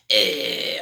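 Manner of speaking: short loud harsh rasp; brief scratchy fry burst as a bad example; scream demo, wrong way. Shown as a demonstration. Coach showing how not to scream, harsh rasp. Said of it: "So instead of sounding like".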